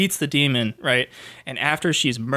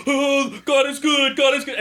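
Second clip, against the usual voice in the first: high-pitched imitation of a helpless priest; mock praying exorcist; 10 semitones higher, slower than usual. Priest with nothing else to say mid-exorcism, mock pleading. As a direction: chant it as a useless, pleading exorcist. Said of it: "And he's like".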